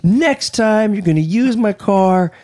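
high, slow scolding imitation; mock nagging wife. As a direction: imitate her nagging about the car. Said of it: "She calls me and she goes".

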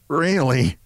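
all she can get out; lightly acted affronted gasp of a word; speechless indignation. A narrator's reading. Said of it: "could bring out was a".

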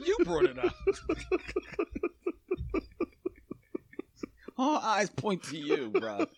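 high laughing words. Real, not acted. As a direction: talk through real laughter. Cracks up at cohost.

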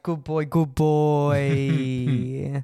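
very slow, drawn-out cooing praise; mock praise. Patting co-host's head, teasingly praising him. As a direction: coo it as if petting a dog.

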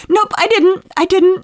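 high, loud mock kid protest; stubborn child denial. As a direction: dig in like a six-year-old caught out. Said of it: "So deny, deny".

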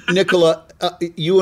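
says the name through a real laugh. Real, not acted. Laughs after microphone envy joke.

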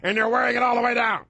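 high, yelling station boss; gruff radio boss. Retold or imitated. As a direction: bark orders like an angry program director. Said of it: "That's the radio I remember".

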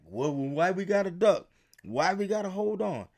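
hammed-up complaining kid; mock whining. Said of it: "we didn't like".

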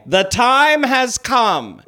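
[name] voice. Retold or imitated. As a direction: draw it out grandly like a drag diva. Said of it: "[name] saying".

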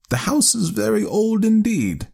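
high-pitched spinster aunt, telling a tale; earnest elderly aunt. Acted. Aunt telling her nephew of the haunted house, earnestly. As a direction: voice an excitable old aunt, high.

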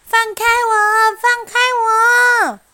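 high, loud desperate yell; panicked yelling. Acted. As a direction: scream to be let go. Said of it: "又踢又叫".

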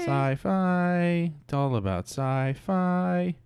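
high, slow, playful; playful high sci-fi. Real, not acted. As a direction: echo it back with relish. Echoes genre playfully.

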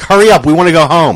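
high and loud, hurrying them along; impatient mock shout. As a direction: yell up from the orchestra pit to get it over with. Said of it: "And he's like".

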